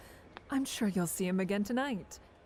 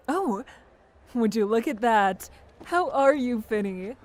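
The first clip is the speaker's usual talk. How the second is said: louder, gushing hello; delighted loud greeting; 9 dB louder than usual. Acted. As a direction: call out sweetly to a favourite kid. Gabby spots Finn, loud greeting.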